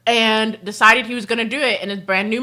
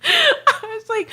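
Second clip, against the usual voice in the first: laughing; 12 semitones higher, slower than usual. Real, not acted. Laughing crying.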